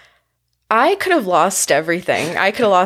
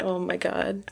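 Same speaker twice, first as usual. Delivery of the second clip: hushed dread; 11 dB quieter than usual. Retold or imitated. Panic remembered, hushed.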